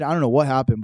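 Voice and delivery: ordinary delivery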